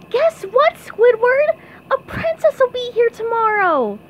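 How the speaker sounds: loud, high, excited cartoon squeal; SpongeBob. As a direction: burst in squeaking the big news. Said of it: "SpongeBob burst into the Krusty Krab".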